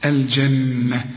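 الجنة slow, with a long nasal nun; doubled nun ghunna. Shown as a demonstration. Teacher to student: الجنة with a humming nun. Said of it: "كل نون لازم تغنها".

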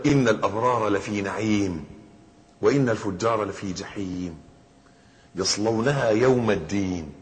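slow, measured Quranic recitation; verse recitation. Acted. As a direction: recite it slowly, drawing out the phrase endings. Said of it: "في شرح قول الله تعالى".

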